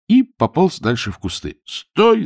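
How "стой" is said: high-pitched cry to halt; shout. A narrator's reading. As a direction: cry out to stop a runaway. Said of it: "закричал Чонкин".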